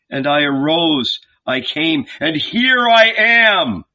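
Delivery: high, loud fervent preacher; preaching. A narrator's reading. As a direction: testify with fervor. Jesus preaching.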